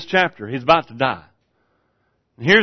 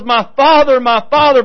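a touch of a weeping cry, higher; 8 semitones higher, 4 dB louder than usual. Retold or imitated. King Joash mourning his mentor, a mild weeping cry. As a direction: call out like a grieving son. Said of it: "he was weeping and he's crying out".

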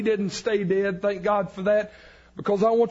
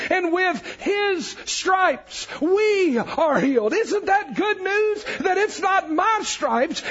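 higher, slowed, ringing out each phrase; preaching at full force; 6 semitones higher, slower than usual. Acted. Preacher to congregation, high and slow at full force. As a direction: lift the room with the good news.

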